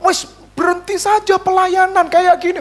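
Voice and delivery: high-pitched exasperated outburst; mock tirade, pitched well up; retold fit of anger. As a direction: imitate a wife flaring up.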